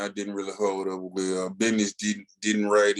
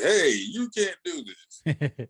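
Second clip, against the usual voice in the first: slow, higher mock proclamation; mock official decree; 9 semitones higher, much slower than usual. Podcast host mocks the mayor's new law, slow and high. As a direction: read it out like a stuffy new law.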